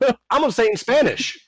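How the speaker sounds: high whiny mock voice; mocking whiny user. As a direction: mimic a pestering user. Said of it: "mocking the user".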